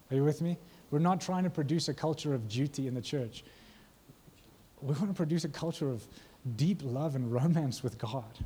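ordinary talk, nothing marked

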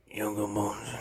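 low, slurred phonetic reading; mock a careless attempt at a foreign line; Steven Seagal. Actor faking an African line on set, low and careless. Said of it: "say these words phonetically".

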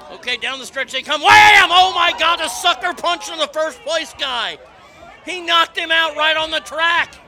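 loud, high race call; play-by-play. Pretend. Host calling the race clip to listeners, loud excited play-by-play. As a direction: shout it like a sportscaster calling the finish.

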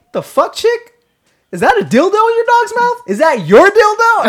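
high, loud incredulous questioning; retold loud outburst. Friend loudly confronting the neighbor over her dog, incredulous. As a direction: imitate the friend calling it out loudly. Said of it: "And all loud".

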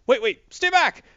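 panicked plea. Retold or imitated. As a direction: Kraven backing off in fear. Kraven panics as Spidey advances.

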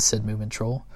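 ordinary delivery, nothing marked

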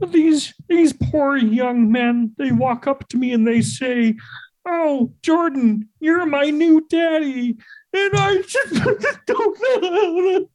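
high, slow, teary mock Peterson voice; Jordan Peterson impression. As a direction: imitate him near tears, voice lifted high. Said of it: "Jordan Peterson happening all the time".

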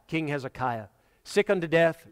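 ordinary delivery

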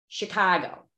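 Chicago accent, long A in "Chicago". Shown as a demonstration. Showing off a Chicago accent, long A in "Chicago". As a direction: stretch the A in "Chicago" like a local. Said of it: "kinda long on the A's".

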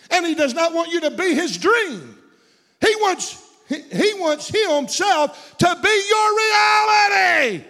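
high, loud shouted preaching; preaching at full force. Acted. Preacher shouting to the congregation at the sermon's peak. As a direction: build to a shouting climax.

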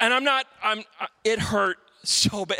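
usual speaking voice

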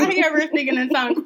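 laughing hard while talking; teasing through laughter. Real, not acted. Laughing at the speaking in tongues.

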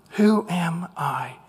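slow whispered Who am I; slow whispered question of self-doubt. Acted. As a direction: breathe it out in shame. Moses's question again, whispered.

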